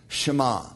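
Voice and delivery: "Shema." again as shuh-MAH, stressed end, falling; Hebrew word Shema. Shown as a demonstration. Teacher giving the Hebrew word for obey, shuh-MAH. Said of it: "she would say".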